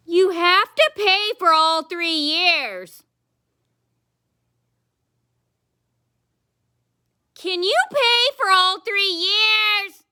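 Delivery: high, loud, very slow mocking whine; mocking whiny clerk. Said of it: "And she goes".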